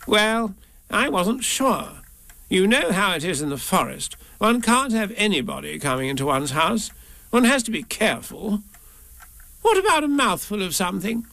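high-pitched prim creature voice; Rabbit, a cautious fussy host. A narrator's reading. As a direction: excuse your caution, then offer a bite. Rabbit explains and offers food.

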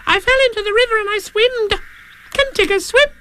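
tiny, very high squeaky youngster; high-pitched eager little voice; Roo's voice. Acted. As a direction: squeak the question like a curious little one. Roo's figure voice.

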